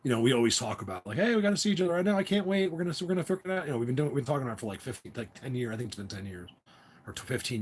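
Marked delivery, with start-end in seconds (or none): 1.2-3.7 high, rushed eager chatter; keyed-up, fast and high-pitched. Retold or imitated. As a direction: rattle it off high with excitement. Acting out friends' eager exchange.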